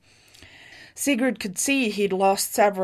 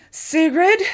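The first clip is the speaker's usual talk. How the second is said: high, loud disbelieving question; as if seeing a ghost; confused; 9 semitones higher, 7 dB louder than usual. A narrator's reading. Husband seeing his wife alive, confused disbelief. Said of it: "he said, confused".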